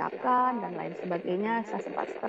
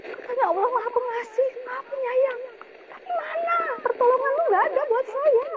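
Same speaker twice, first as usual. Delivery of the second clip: much higher, strained cry at God; retold anguished rage; 14 semitones higher, 4 dB louder than usual. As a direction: hurl the accusation upward.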